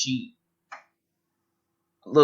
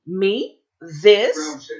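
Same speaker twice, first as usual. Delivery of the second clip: surprised; 6 semitones higher than usual. Acted. Lavinia flattered, fishing for praise.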